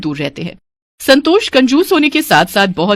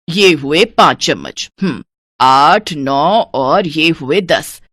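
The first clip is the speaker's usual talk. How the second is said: slow stingy counting; 4 semitones lower, much slower than usual. Acted. Stingy mother-in-law counting spoonfuls.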